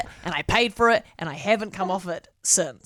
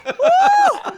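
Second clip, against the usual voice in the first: loud, high "woo"; excited whoop; 7 dB louder than usual. Real, not acted. Cheering the Irish voice actor with a high whoop.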